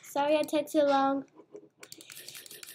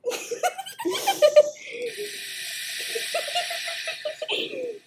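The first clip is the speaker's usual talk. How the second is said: long high giggling shriek; high shrieking laugh; 14 semitones higher than usual. Real, not acted. After burning food.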